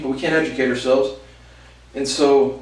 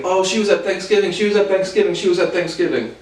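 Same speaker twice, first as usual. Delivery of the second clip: higher-pitched, lightly mocking repetition; mimicking family gossip; 5 semitones higher than usual. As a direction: parrot the relatives' chatter. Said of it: "they're all talking about".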